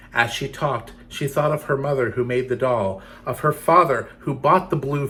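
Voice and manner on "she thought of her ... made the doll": usual speaking voice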